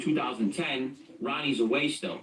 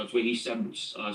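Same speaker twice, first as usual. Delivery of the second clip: normal talking voice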